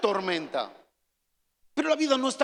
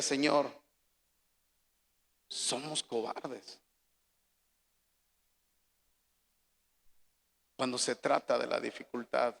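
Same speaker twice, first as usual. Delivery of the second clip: much softer, lower, hushed confession; a broken man praying quietly through tears; a hint of weeping, barely above a murmur; tearful prayer; 7 semitones lower, 7 dB quieter than usual. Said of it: "empecé a llorar".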